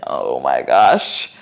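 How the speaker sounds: low, slow, spent gasp; out of breath. Real, not acted. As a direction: sag after the effort. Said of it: "My abs are on fire".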